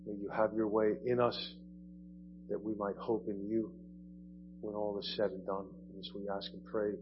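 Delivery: soft, hushed prayer voice. Acted. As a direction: pray quietly and reverently. Opening prayer.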